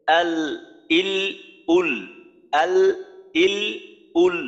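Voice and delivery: আল, ইল, উল with the lam voiced briefly; teacher holds the lam of আল, ইল, উল for a short moment; demo sakin lam. Shown as a demonstration. Teacher shows students the sakin lam, held briefly. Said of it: "যেমন দেখেন".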